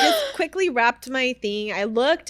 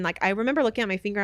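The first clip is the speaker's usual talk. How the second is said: ordinary delivery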